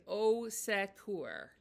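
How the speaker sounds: "au secours" dragged very slowly, every syllable spelled out; the stiff learner version; over-careful French. Shown as a demonstration.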